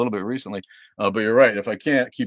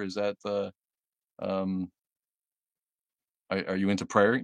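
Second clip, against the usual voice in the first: usual speaking voice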